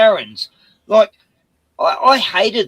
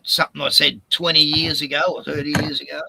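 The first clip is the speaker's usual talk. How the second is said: usual speaking voice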